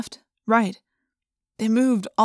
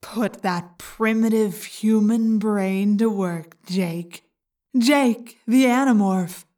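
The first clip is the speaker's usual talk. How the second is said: sneering; much slower than usual. A narrator's reading. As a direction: sneer down at the human. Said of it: "it sneered".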